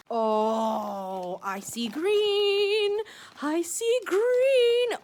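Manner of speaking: high, slow, stretched-out delight; awed excitement. Real, not acted. Glimpsing green on a gift abalone, excited and drawn out. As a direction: draw the words out, rising high in anticipation.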